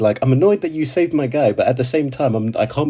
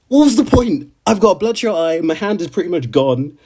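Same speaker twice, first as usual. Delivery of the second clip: imitated despairing Subaru; 7 semitones higher than usual. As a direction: cry out in hopeless frustration.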